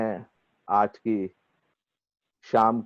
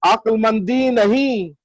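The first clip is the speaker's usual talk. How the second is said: measured, higher mushaira declamation with lengthened vowels; ghazal recitation; 9 semitones higher, 4 dB louder, slower than usual. Acted. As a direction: recite the verse grandly, drawing out each phrase.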